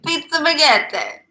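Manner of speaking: loud, slow hammed Italian accent. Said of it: "That's how I do an Italian accent".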